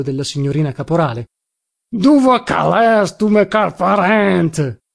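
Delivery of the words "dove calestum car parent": very slow, stretched Turinese holler; thick Turinese shout. A narrator's reading. Drunken visitor still seeking his relative, shouting slowly in Turinese. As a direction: call out in broad Turin dialect, dragging every word. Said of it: "si mise a gridare con stretto accento torinese".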